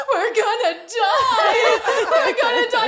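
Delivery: very high wail, stretched out, half laughing; mock hysterical crying. Mother imitating daughter over deadly snail, mock hysterics. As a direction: imitate a child's panicked screaming wail. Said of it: "She starts hysterically crying".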